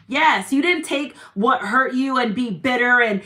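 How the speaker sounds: ordinary talk, nothing marked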